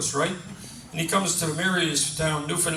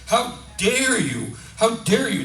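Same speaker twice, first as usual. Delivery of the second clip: acted outraged cry, raised pitch, drawn out; indignant yell; 6 semitones higher, slower than usual. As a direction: call out in comic indignation. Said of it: "she yells out".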